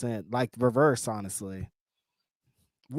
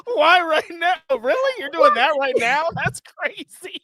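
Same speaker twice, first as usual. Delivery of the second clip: mocking incredulity; 6 semitones higher, 4 dB louder than usual. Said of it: "mocking cohost's reaction".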